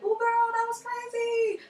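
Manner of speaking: put-on high, sing-song valley girl chatter; gossipy friend voice. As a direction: gush like a giddy college friend. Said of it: "you guys are talking like".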